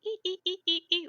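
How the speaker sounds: high-pitched imitated mouse squeaking; mousy squeaks. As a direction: squeak repeatedly like a little mouse. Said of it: "mousy noises. Like, it makes, like".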